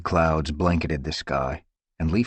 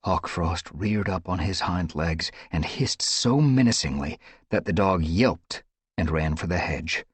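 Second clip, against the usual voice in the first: plain reading voice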